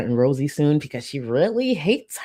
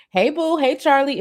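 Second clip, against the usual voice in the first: bright, higher-pitched playful greeting; requested shout-out; 9 semitones higher, 4 dB louder than usual. Pretend. Streamer greets a viewer who asked for a hey boo, playful shout-out. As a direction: sing out a hello to a fan. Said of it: "can I get a hey boo".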